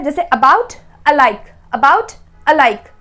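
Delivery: about with a short, weak first a, said slowly; slow classroom demo of about and alike. Shown as a demonstration. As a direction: stretch out about with a clipped uh sound. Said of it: "about कम समय के लिए".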